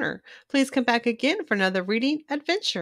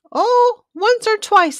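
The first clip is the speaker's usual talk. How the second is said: much higher, louder sassy Joey; teasing fish voice; 13 semitones higher, 9 dB louder, slower than usual. Acted. Joey Mackerel teasing Clark about the brownies, high sassy voice. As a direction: squeak it out with a teasing eye-roll.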